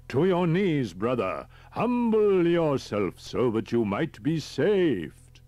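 high, loud revivalist cry, drawn out; zealous preaching shout. Acted. Cult leader forcing his captive down, loud preaching command. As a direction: thunder it out like a fervent preacher.

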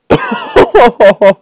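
high-pitched burst of laughter. Real, not acted. Gamer failing first swing, high laughing. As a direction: crack up in a high giggle.

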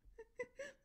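soft high-pitched giggle; a quick delighted giggle; squeaky little laugh. Real, not acted. Laughing at D A joke.

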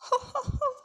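very high gasp. A narrator's reading. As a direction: gasp in fright. Animals gasp.

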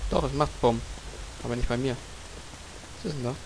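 ordinary delivery

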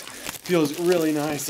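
usual speaking voice

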